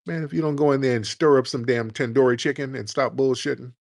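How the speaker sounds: ordinary talk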